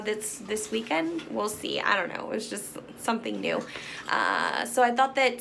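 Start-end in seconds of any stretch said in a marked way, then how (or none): none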